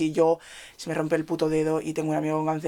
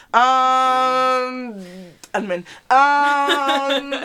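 mock amen; 6 semitones higher, 5 dB louder, much slower than usual.

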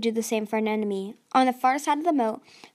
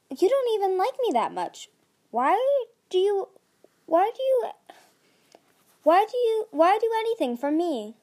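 bewildered; 9 semitones higher, 3 dB louder than usual. A narrator's reading. Anemone upset and bewildered.

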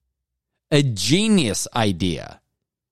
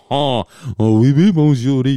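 loud, higher mock-French exclamation; 4 semitones higher, 7 dB louder than usual. Host playing at sounding French after laughing, mock French accent. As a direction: put on a silly, half-convincing French accent. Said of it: "I know that sounded French".

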